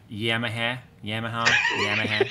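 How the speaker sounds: Yamaha with "a" as in cat, then varied; mocking his accent. Friend making fun of his accent, repeated mock mispronunciation. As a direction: mimic his odd Yamaha over and over. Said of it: "don't make fun of my accent".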